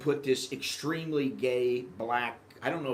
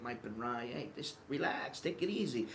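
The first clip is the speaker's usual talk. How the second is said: calm Thai shrug; 7 dB quieter than usual. Retold or imitated. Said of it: "it's like".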